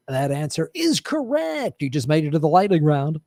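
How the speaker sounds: a game show host congratulating the contestant. Shown as a demonstration. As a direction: announce it like a quiz host. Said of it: "I'm gonna talk to you in my game show voice".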